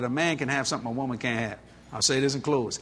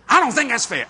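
retold protest; 6 semitones higher, 7 dB louder than usual. Wife protests in retold quarrel.

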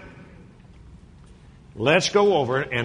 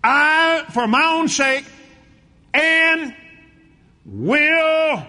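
loud, high shouted preaching; full-force revival shout; 13 semitones higher, 7 dB louder, much slower than usual. Acted. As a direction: shout it out over the congregation. Shouting the scripture.

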